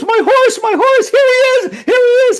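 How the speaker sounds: high, loud hammed-up screaming; frantic yelling. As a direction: act out a villager screaming that he has spotted it. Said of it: "starts crying and starts yelling and starts screaming".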